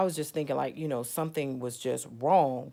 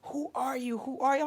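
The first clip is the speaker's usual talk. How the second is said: high-pitched mock scream, not much louder; retold scream; 11 semitones higher than usual. Imitating the lady screaming in the phone, mock scream. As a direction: imitate a frantic caller, pitched way up. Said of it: "she kept screaming loud in the phone".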